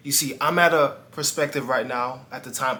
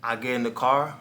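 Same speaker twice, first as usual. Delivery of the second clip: ordinary talk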